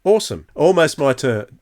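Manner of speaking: usual speaking voice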